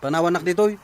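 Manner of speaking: loud, angry outburst. Acted. Wife lashing out at husband in a quarrel, angry and loud. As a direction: snap back at him loudly.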